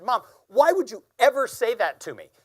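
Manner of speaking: hurt shout at the mother; half-sobbing angry yell at her dying mother; angry cry. Retold or imitated. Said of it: "but I was angry".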